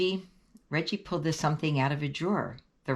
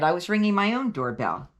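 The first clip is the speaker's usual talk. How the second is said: plain reading voice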